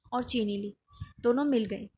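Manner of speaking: normal talking voice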